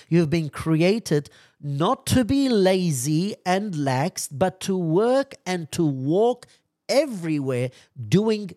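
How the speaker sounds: high, loud preaching; full-force preaching. Real, not acted. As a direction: drive the point home to the flock.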